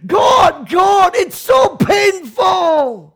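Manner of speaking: very slow, loud, high complaint; crying out in pain. Retold or imitated. Imagined Jesus complaining loudly to God. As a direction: stretch out the pain. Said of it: "cry it out and say".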